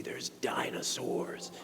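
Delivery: slow hushed whisper; a slow whispered tease. Retold or imitated. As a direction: breathe it out like a secret. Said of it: "he was like".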